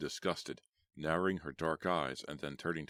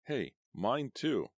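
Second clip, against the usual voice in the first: slow, higher smirking reply; 8 semitones higher, much slower than usual. Acted. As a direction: toss it back with a smirk. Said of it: "Bobby replied with a smirk".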